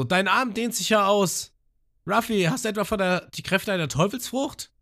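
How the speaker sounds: high, amazed character voice; Abis imitated. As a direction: ask it in wide-eyed wonder. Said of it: "Und sie so". (hohe, staunende Stimme)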